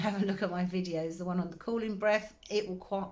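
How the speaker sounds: ordinary talk, nothing marked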